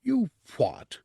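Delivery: sharp, high "You what?"; disbelief. Acted. The teacher reacts in disbelief to his student's confession, high and sharp. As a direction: jump up in shock.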